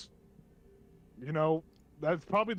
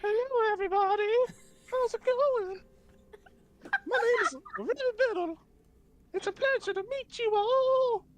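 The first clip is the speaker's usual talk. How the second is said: squeaky gnome voice, very high; high, annoying gnome; 4 dB louder than usual. Acted. Gnome Riven meets the party, high annoying voice. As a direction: introduce yourself in an absurdly high voice. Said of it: "a little bit higher pitch and a little annoying".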